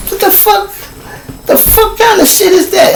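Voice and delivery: very high-pitched baffled protest; incredulous, voice way up high; retold bewilderment. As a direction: squeal it in mock disbelief. Retold bewilderment at being thrown.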